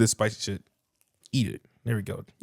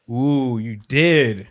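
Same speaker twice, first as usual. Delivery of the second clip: teasing drawl; much slower than usual. Real, not acted. Drawn-out teasing.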